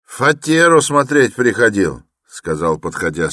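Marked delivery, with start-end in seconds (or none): 0.0-2.0 high, loud voice of a workman; workman accusing. A narrator's reading. Senior workman tells porters about the visitor, high and loud. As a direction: report on the stranger loudly. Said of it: "старший работник".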